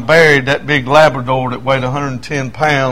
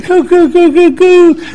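high, louder mock cooing at the dog; 14 semitones higher, 4 dB louder than usual. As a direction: gush over the little dog. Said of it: "it just goes on and on".

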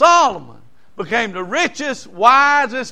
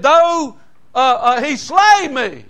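rising preach; 5 semitones higher, 4 dB louder, slower than usual. Acted. Quoting Job's declaration, preaching.

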